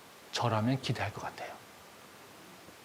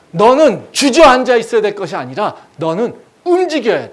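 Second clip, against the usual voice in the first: loud, raised commanding voice; emphatic pulpit shout; urgent exhortation; 10 semitones higher, 16 dB louder than usual. Acted. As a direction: push them forcefully to get up. God urging the discouraged to act.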